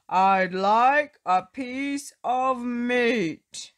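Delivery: slow, loud booming giant voice; loud booming demand. Acted. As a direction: boom it out, big and slow. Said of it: "he boomed".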